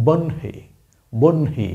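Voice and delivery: বহ্নি as "bonhi", a clear ন then a light হ; pronunciation demo. Shown as a demonstration. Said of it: "আপনি আগে উচ্চারণ করবেন ন স্পষ্টভাবে, আর হালকা সাউন্ডে আসবে হ". (বহ্নি: ন স্পষ্টভাবে, তারপর হালকা হ)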